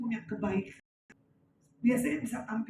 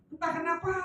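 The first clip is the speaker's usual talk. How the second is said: ordinary talk, nothing marked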